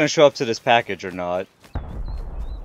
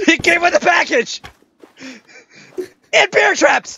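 excited; 11 semitones higher than usual. Real, not acted. Gloating over loot.

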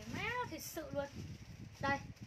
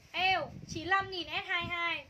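drawn-out sales call; 2 semitones higher, 4 dB louder, much slower than usual. Acted. Excited slow sales call.

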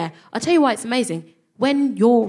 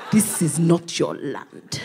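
ominous Nigeria; 4 semitones lower, slower than usual. Retold or imitated. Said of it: "hear Nigeria going".

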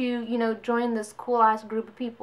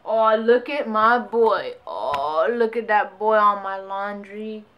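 cooing at pet; 5 dB louder, slower than usual. Real, not acted. Owner spotting her pet on the laundry, slow doting coo.

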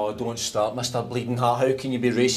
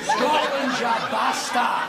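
high-pitched defiant battle cry; defiant yell; 12 semitones higher, much slower than usual. Acted. Trapped passenger cursing the lift, high defiant yell.

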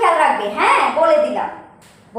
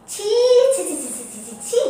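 disgusted tut-tutting; 3 semitones higher than usual. Acted. Mother shames son.

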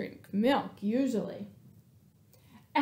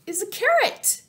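character voice; 9 semitones higher, 4 dB louder than usual. Acted. Character voice in children's story.

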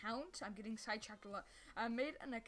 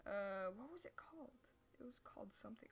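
whispering; 9 dB quieter than usual. Real, not acted. Muttering while trying to remember.

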